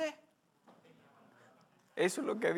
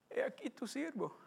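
softer, bowed-down servant's answer; humble, hushed reply; 4 semitones higher, 14 dB quieter than usual. Acted. (siervo humilde, en voz baja)

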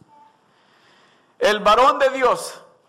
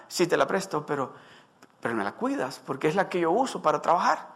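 lower, softer owner granting the loan; lender's cautious reply; 4 semitones lower, 7 dB quieter, faster than usual. Acted. Owner lending the axe, warning him quietly. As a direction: hand it over with a quiet warning.

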